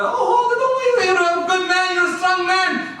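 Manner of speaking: put-on high-pitched fussing father soothing his boy; reassuring father. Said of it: "And the father".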